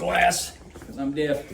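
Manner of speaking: muffled behind glass; talking through a window; through a glass partition. Real, not acted. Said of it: "I hate talking through the".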